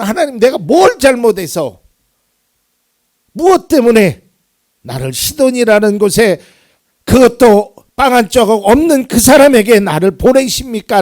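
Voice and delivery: loud, high, pleading cry to God; anguished outcry. Pretend. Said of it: "엘리야의 그 느낌처럼 우리 또한 그런 절규의". (하나님께 울부짖는 절규)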